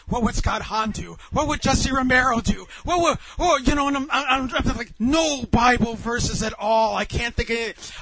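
high, loud, flustered scrambling; frantic self-talk, played for laughs; mock panic. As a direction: rattle off names as your mind goes blank. Frantically searching for verses mentally.

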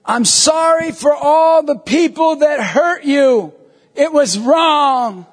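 slow, high call across distance. Retold or imitated. Priest to hurt strangers across the street, slowly calling an apology. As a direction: stretch each phrase so it carries.

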